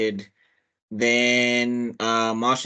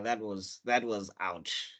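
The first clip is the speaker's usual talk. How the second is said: hushed dismay; 4 semitones lower, 10 dB quieter, slower than usual. Real, not acted. Soft after the sad scene.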